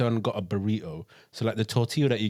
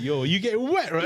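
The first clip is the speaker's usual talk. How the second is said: slangy imitation, laughing, raised a little; mock London slang; 6 semitones higher than usual. Teasing a friend's accent, London slang. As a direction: tease him as a London road man. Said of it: "very good accent".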